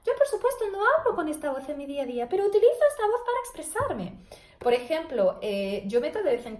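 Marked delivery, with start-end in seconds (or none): 0.0-4.2 falsetto head voice. Shown as a demonstration. Therapist shows students her falsetto head voice. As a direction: lift the whole sentence into head voice. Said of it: "la voz de falsete".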